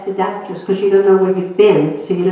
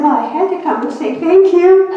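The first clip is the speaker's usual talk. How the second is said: much higher, said through a laugh; laughing reply; 11 semitones higher, 5 dB louder than usual. Retold or imitated. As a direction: laugh at her own small gift. Her wry reply, laughing.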